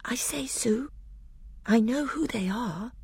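put-on soft, high, breath-light girlish whisper. A narrator's reading. Lucy tells Susan what she remembered, whispering. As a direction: whisper it as a secret, lifted and hushed. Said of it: "whispered to Susan".

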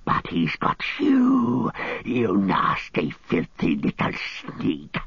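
venomous hissing creature, slow and drawn out; Gollum. A narrator's reading. Gollum turning on Sam in hatred, slow venomous hiss. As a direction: spit out the insult slowly, rising to a screech on 'you'. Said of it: "hissed Gollum in his ear".

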